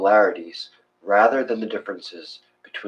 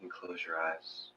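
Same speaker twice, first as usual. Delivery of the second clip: soft, hushed meditation voice with long pauses; guided meditation; 3 semitones lower, 10 dB quieter than usual. Acted. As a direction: ease them into the breath, quietly.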